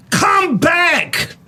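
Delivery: high-pitched imitated scream, far above normal. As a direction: yell it like a frantic woman calling them back.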